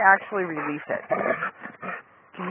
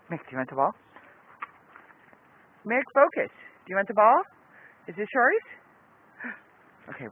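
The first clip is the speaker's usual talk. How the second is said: ordinary talk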